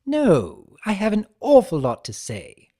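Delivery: loud, high, bouncy trickster; energetic. Acted. Chaos spirit answering the centaur, energetic and loud. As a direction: pop every word with cheery energy. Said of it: "in his characteristic energetic tone".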